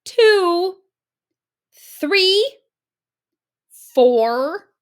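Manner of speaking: high, loud, stern counting; shouted countdown. A narrator's reading. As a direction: count it out to scare him off. Counting at the wolf.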